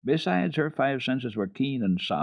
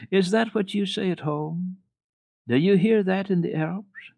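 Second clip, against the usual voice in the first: put-on high, lightly teasing old lady; amused grandmother; 11 semitones higher, 4 dB louder than usual. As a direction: ask it with a delighted lift. Grandmother voiced in story.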